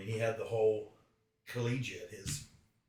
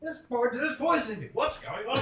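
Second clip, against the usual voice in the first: high-pitched comic cry of alarm; high, louder hammed-up protest; mock alarmed outcry; 13 semitones higher, 5 dB louder than usual. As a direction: cry out in exaggerated disbelief. Acted outcry at being poisoned.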